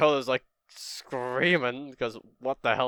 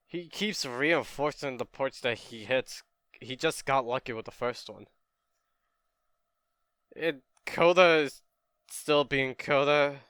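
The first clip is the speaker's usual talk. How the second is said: usual speaking voice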